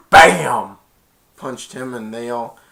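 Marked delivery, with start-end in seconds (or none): 0.1-0.8 loud "bam" for a punch; punch sound. Retold or imitated. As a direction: punctuate the blow with a shouted bam. Imitating a punch.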